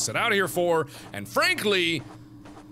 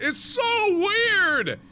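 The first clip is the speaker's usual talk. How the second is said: much higher, louder and drawn out; shouted complaint; 6 dB louder, much slower than usual. Acted. Fran baffled by the fairies, in a high drawn-out shout. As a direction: wail it in frustration. Confused girl crying out.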